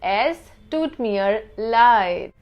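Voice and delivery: "Es tut mir leid" said slowly, each word apart; slow demo. Shown as a demonstration. Teacher slowing down the German apology for students. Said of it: "how to say I'm sorry".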